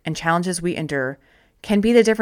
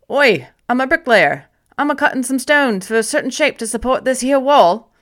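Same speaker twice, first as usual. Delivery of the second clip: louder, higher mock Cockney bricklayer; put-on Cockney accent; 5 semitones higher, 4 dB louder than usual. First bricklayer answering Wren in a mock Cockney accent. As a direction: ham up a Cockney workman's accent. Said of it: "What do you think of my accent?".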